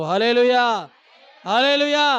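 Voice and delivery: loud ringing hallelujah; hallelujah shout. Real, not acted. Preacher calls out to the congregation in a loud hallelujah shout. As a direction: call it out to the congregation.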